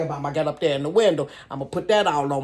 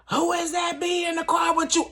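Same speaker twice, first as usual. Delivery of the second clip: shrill-pitched phone shout, much higher; high mock shout; 12 semitones higher than usual. The woman on the phone yelling at him about the passenger. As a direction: shout the jealous question way up high.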